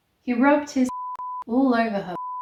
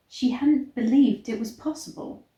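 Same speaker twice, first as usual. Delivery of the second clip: plain reading voice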